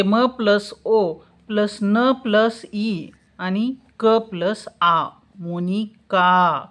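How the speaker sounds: slow, letter by letter, each sound laid out; slow spelled breakdown. Shown as a demonstration. Teacher breaking a name into letters for children, slow and deliberate. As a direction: spell the name out slowly for the class. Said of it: "फोड करून घेतली आहे".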